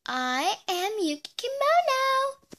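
a hint of a preschooler introducing herself; little kid voice. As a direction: say it like a tiny four-year-old. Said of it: "You sound like a five-year-old".

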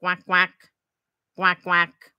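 lightly voiced quacking; duck quacks. Shown as a demonstration. Librarian quacking like a duck for the kids, lightly. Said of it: "qué dice el pato?".

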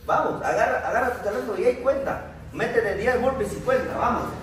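high, loud, pushing call to action; shouted workout countdown call; coach's rallying shout. Real, not acted. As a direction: fire them up into the next set. Coach urging group into burpees.

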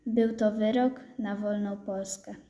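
ordinary delivery

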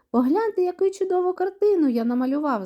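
high, excited boast; delighted shout. A narrator's reading. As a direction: show it off excitedly, voice lifted high. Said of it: "захоплено кричить він". (захоплений вигук високим голосом)

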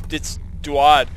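ordinary talk, nothing marked

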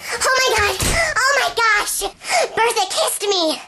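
high, breathless squeal of joy; fake excitement. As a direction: gush as if just kissed, hammed-up. Fake excited news, then says just joshing.

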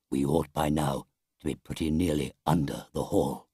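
low, hushed-down elder voice; Badger in the passage. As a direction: say it low, as a cautious leader. Badger speaks in the secret passage.

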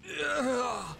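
loud, high retching "ugh"; disgusted groan. Retold or imitated. Streamer voicing his disgusted second thought, loud groan. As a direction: blurt out a loud grossed-out groan. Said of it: "the other thought was".

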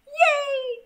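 high, bright little cheer; excited cheer. Real, not acted. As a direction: cheer it out happily. Cheering the start.

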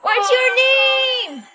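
a high, drawn-out "What's your name?" asked again; high slow repeat. Real, not acted. Streamer repeating her question to a silent viewer, high and slow. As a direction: call out to the viewer slowly and high.

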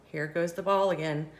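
plain reading voice

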